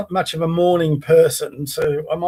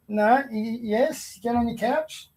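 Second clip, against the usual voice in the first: much softer, hushed order to the dog; soft firm command; 4 semitones higher, 9 dB quieter, slower than usual. Real, not acted. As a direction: quietly insist the dog go back. Quietly ordering dog back to couch.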